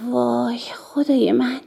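breathless slow whisper; hushed, dazzled whisper to a friend. A narrator's reading. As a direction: whisper it in awe, barely voiced. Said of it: "سوزی زمزمه کرد".

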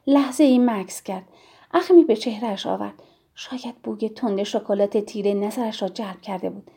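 usual speaking voice

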